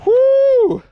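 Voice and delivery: high, loud cheering whoop; excited whoop. Real, not acted. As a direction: let out a triumphant whoop. Catching a fish, cheering.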